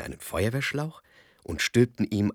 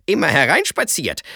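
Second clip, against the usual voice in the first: triumphierender Ruf; 10 semitones higher, 12 dB louder than usual. Acted. Kasperl schlägt Hotzenplotz den Helm ab.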